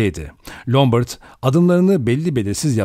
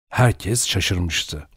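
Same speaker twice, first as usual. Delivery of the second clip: ordinary delivery, nothing marked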